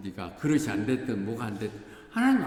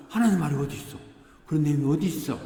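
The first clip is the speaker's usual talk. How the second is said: astonished exclaiming; 5 semitones higher, 6 dB louder, faster than usual. Retold or imitated. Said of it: "너무 놀라면서".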